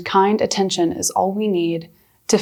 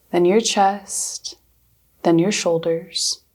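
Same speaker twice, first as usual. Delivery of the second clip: guided meditation. Acted. Counseling intern guiding viewers through breathing, calm meditation voice.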